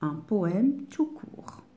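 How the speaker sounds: ordinary delivery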